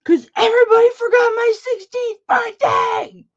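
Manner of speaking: sobbing, high, loud outburst; crying scream. Retold or imitated. Teen to mom about forgotten birthday, crying scream. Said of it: "I just screamed at her".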